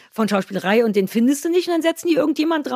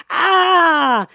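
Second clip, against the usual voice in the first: higher, louder stiff fake scream; held-back studio yell; 7 semitones higher, 6 dB louder than usual. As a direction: scream like an idiot without meaning it. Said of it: "Schreien nachgemacht".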